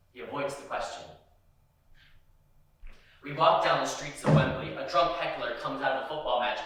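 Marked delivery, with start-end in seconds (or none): none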